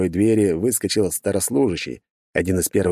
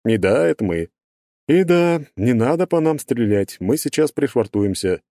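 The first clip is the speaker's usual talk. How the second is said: higher, playful fencer voice; smug, lecturing character voice; 5 semitones higher than usual. Acted. Burbulis over radio.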